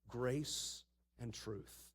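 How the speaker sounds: very slow and soft; hushed, drawn-out refrain; solemn refrain. Acted. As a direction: lay each word down slowly. Sermon refrain.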